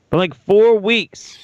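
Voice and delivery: emphatic. Real, not acted. Repeats announcement louder.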